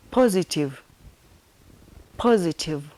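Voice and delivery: ordinary talk, nothing marked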